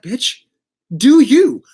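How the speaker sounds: high, punchy and loud on do you; sassy comeback. Pretend. Reader answering clients who beg the cards, sassy and loud. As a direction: toss it off with full sass.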